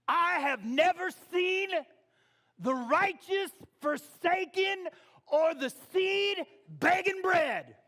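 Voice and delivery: high, loud, drawn-out southern preacher delivery; southern preacher twang. Shown as a demonstration. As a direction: belt it like a fiery southern preacher. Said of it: "with the right, like, southern twang".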